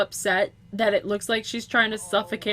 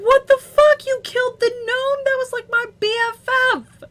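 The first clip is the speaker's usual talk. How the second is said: loud, high-pitched mock outrage; pissed-off Grim Reaper; 12 semitones higher, 9 dB louder than usual. Grim Reaper scolding the gnome's killer, pissed off. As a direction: yell it as the outraged, bereaved buddy. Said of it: "The Grim Reaper's just pissed off".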